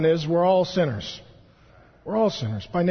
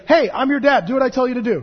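loud, higher bossy father voice; stern dad; 5 semitones higher, 5 dB louder, faster than usual. Retold or imitated. Dad ordering his kids to obey, a loud bossy demand. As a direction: bark orders like a domineering dad. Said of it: "look at my kids and say".

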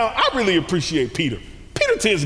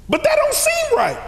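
much higher, louder protest; self-righteous believer protesting, high; mock objection; 5 dB louder than usual. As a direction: pitch up in mock disbelief.

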